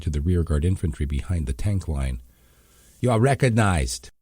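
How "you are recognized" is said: louder, a hint of irritation; irritated call. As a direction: call it out, annoyed. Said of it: "an irritated voice called".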